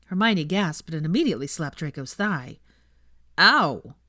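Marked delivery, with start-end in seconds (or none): 3.4-3.9 yelp. Acted. Slapped on the thigh.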